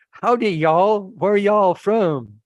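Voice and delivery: high, slow mock Texas drawl; Texas accent. Shocked guest mimicking the owner's son, in a mock Texas accent. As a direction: imitate a Texan greeting with a stretched accent. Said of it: "and with a Texas accent".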